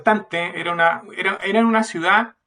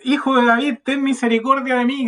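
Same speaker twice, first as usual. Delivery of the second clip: pleading cry raised higher in pitch; louder cry out; 5 semitones higher than usual. Retold or imitated. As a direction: call out to Jesus, pleading. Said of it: "gritaba mucho más fuerte". (el ciego clama más alto y suplicante)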